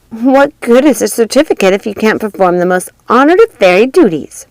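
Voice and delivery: haughty schoolgirl scoff; mocking fairy. A narrator's reading. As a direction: sneer down at her rival. Flora mocking Maleficent.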